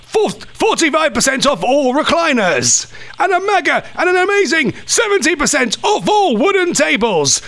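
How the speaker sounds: excited furniture sale voiceover; hard sell ad. Acted. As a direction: pitch it like a sofa sale ad. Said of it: "sounds a bit like I'm doing an ad".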